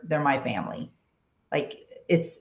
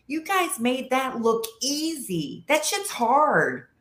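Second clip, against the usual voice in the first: mock indignation; 8 semitones higher, slower than usual. Venting at her friends.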